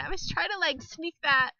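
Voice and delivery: ordinary talk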